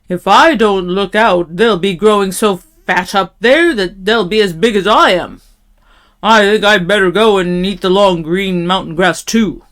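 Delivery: loud big-goat musing. A narrator's reading. Big goat deciding to go eat grass, loud voice. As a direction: reason it out loudly as the biggest goat.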